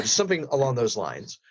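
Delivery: ordinary talk, nothing marked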